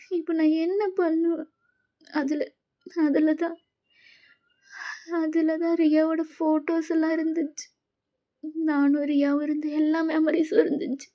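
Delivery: high-pitched, slow, tearful sobbing; sobbing hard. Acted. Daughter sobbing to her dad over the broken camera. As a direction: plead through heavy tears. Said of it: "செம்மையா அழுதுட்டு இருக்கீங்க".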